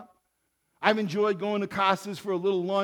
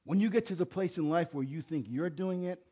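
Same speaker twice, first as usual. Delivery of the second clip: ordinary delivery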